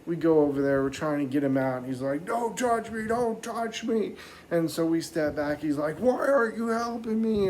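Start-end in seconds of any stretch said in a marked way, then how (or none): 2.3-4.2 high-pitched mock protest; panicky indignant dad. Trapped dad refusing help, acted. 6.0-7.5 high, loud mock wail; indignant trapped dad. Trapped dad demanding help, acted.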